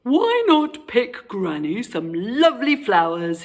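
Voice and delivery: sly wolf voice. A narrator's reading. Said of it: "he said".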